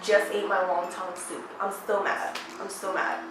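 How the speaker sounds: low, soft mock seething; quietly fuming. Mom tells daughter about eaten soup, quietly fuming. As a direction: drop the voice and fume.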